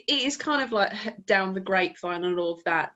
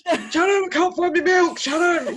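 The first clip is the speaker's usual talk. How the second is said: loud, high, helpless holler; hammed-up rock star yelling for help; 9 semitones higher, 6 dB louder than usual. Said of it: "you just see [name] there".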